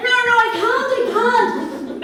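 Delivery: loud, high frantic protest; hammed-up urgent plea; desperate refusal. Girl insists she must go out, loud frantic protest.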